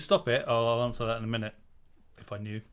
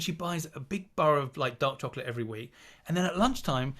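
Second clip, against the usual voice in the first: ordinary delivery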